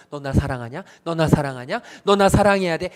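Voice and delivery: a stalker's pressing questions, building; insistent, rising in volume to a loud demand; pushy stalker demand. Retold or imitated. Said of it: "스토커처럼 쫓아오셔서".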